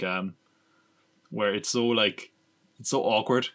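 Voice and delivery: ordinary talk, nothing marked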